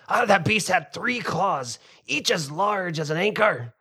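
put-on loud, high old salt boasting; gruff fisherman. Fisherman gossiping to villagers, loud and rough. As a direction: bellow it like a weathered fisherman.

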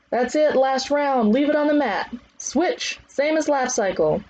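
high, projected commands; trainer's last-round calls. Acted. Trainer urging class through last round, high calls. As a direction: push them through the final round.

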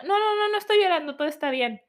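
high-pitched, hurried denial, brushing it off; a mother caught crying insists she's fine; flustered mother denying. Acted. Mother to child, denying her tears, high flustered denial.

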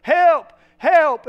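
loud, high shouts of help; man stuck in a hole, shouting up for help; yelling for help. Acted. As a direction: cry out from the bottom of the hole. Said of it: "He begins to yell".

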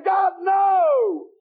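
high, drawn-out cry of protest; preacher's hammed-up scream of refusal; acted wailing scream. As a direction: wail it out like a sinner being dragged off. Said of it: "have a fit and scream and wail".

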